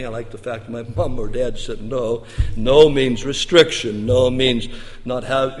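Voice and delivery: usual speaking voice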